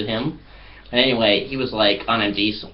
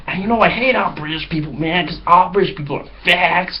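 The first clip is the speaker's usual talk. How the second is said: mock bigoted American; 8 semitones higher than usual. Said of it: "who was just like".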